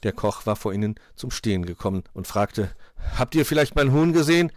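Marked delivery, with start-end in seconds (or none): 3.2-4.5 louder, higher character question; inquiring cook. A narrator's reading. Cook asks animals about hen.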